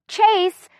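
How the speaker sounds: high, sharp cry of the name; exasperated outburst. Acted. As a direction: exclaim it in exasperation.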